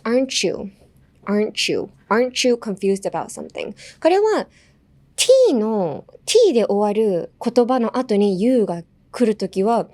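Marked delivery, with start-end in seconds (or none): 0.0-3.7 "you" said like "chew" after "Aren't"; linked "Aren't you". Shown as a demonstration. Teacher models pronunciation, "Aren't you" as "aren-chew". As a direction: run "Aren't you" together with a ch. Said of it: "chewみたいに言います".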